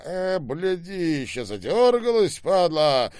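high, loud snarled insults; aggressive abuse. A narrator's reading. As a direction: yell abuse mid-struggle. Abuse shouted during a fight. (злая брань на повышенных тонах)

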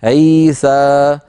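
عيسى drawn out slowly in pausal form; stopping demo of عيسى; pausal stop on عيسى. Shown as a demonstration. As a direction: hold عيسى long, stopping on it. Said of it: "لو وقفنا على عيسى".

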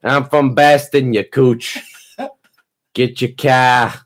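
slow, hammed-up Boston accent, no r in car; Boston accent as a mock tough guy. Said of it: "can I do a Boston accent".

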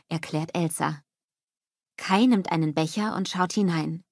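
plain reading voice

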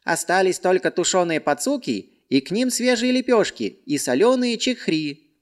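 high, eager servant voice; obsequious servant. Acted. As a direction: offer it like a fawning servant. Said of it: "услужливо предложил служка".

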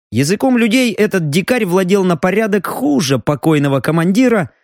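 ordinary delivery, nothing marked

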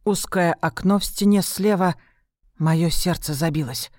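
usual speaking voice

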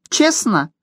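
high gnome voice; gnome asking. Acted. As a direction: squeak it out warily as the gnome. Gnome asks, voice replies.

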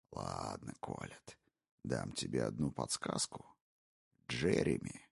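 low, hushed, gloating whisper; a predator savouring every word; menacing intruder. Acted. Intruder taunting the wife, low sinister whisper. Brogan's voice.

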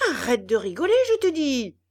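loud threatening yell, rising on je te dis; menacing yell. A narrator's reading. Geppetto yelling a threat at the puppet mouth, menacing. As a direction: yell it as a final warning. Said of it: "hurla Geppetto d'une voix menaçante".

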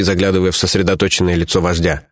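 ordinary delivery

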